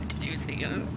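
low secret murmur; low hushed aside. Acted. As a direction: lean in and murmur.

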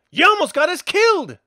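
high, loud furious outburst; angry shout. Acted. At the man who nearly got them killed, a loud furious yell. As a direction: yell it, furious at the near miss. Furious after near capture.

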